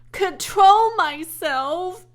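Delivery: high, loud, slow indignant yell; outraged girl yelling at her father. A narrator's reading. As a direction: shout it back in disbelief. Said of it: "yelled Fern".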